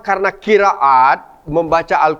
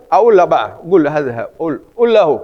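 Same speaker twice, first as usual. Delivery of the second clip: colloquial Arabic, qaf dropped or said as g; everyday Egyptian Arabic. Shown as a demonstration. Teacher mimics fast everyday Arabic speech. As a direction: rattle them off the casual Egyptian way. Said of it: "Bahasa harian ini, cepat itu".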